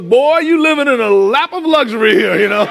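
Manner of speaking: proud Fred Sanford showing off his junkyard; Fred Sanford impression. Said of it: "looks at his junkyard and goes".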